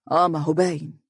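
understated, sceptical scoff; a touch of sarcasm under the breath; sarcastic murmur. A narrator's reading. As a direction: scoff briefly, then answer drily. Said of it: "ابتسم بسخرية وقال هامسا".

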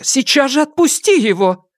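high-pitched cross command; angry order. A narrator's reading. Grandmother making boy release his father, high angry order. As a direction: order the child off, high and insistent. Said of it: "рассердилась матушка".

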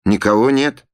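loud, high declaration; announcing. Acted. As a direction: call it out firmly. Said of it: "объявила она".

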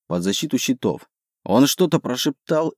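ordinary delivery

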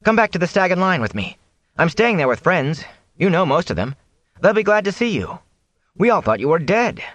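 put-on high, earnest young man; Rand, eager and reassuring. Rand invites the peddler back to his friends, reassuring. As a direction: coax him in a lifted, friendly voice. Young hero's voice in narrated dialogue.

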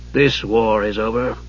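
low, slow, dry reply. A narrator's reading. Raistlin corrects his nephew about war, dryly. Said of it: "Raistlin added dryly".

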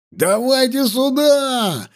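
high, drawn-out call; eager shout. A narrator's reading. Soldiers call the girls over, eager shouting. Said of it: "наперебой закричали столичные воины".

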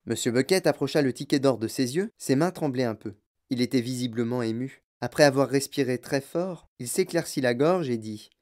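usual speaking voice

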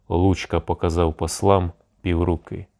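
usual speaking voice

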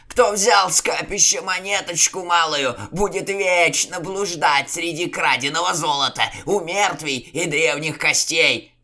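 high, loud, quivering prophecy of doom; trembling terrified curse. A narrator's reading. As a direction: wail it like a doomed man. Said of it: "Яков затрясся".

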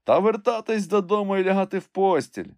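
high, lively urging; eager suggestion. A narrator's reading. Friend urges him to go home to bed, eager. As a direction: jump at the chance, voice lifted. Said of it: "радо вхопився я за нагоду".

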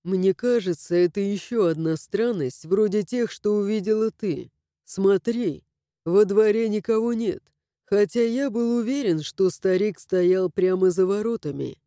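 lightly voiced young man; steady male character voice; Harash male voice. A narrator's reading.

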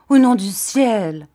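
supplication lancée fort; suppliant. A narrator's reading. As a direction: implorer qu'elle s'arrête. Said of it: "dis-je d'un ton suppliant". (ton suppliant)

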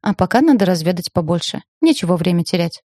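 ordinary delivery

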